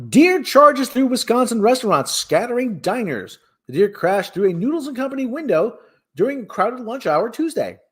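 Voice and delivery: news read. Acted. Host reading animal news to viewers, high newscaster delivery. Reads news story aloud.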